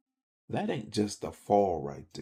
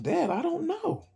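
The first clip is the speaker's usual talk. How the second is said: put-on much higher boyish shrug; younger self. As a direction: play the kid dodging his dad's question. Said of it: "I'm like".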